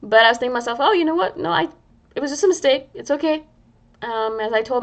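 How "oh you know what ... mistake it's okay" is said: higher, gentle reassurance like comforting a friend; a higher, kinder tone to herself. Retold or imitated. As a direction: soothe yourself as a kind friend would. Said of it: "when I was pretending to be a friend".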